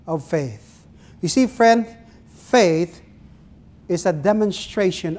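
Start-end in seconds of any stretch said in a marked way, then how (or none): none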